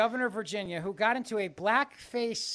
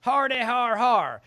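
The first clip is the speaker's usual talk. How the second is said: mock laugh; 3 semitones higher, 6 dB louder, slower than usual.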